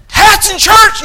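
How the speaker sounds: high-pitched, loud mock rant; indignant shout; explosive outburst. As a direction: burst out yelling like a worked-up preacher. Acting out exploding about hats.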